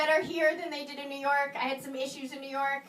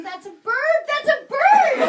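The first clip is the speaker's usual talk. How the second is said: imitating the Berkeley guy, higher and louder; prim, earnest correction, rising to a squeal; mock birdwatcher; 10 semitones higher, 11 dB louder, slower than usual. Stranger on the street insists it is a bird, high and loud.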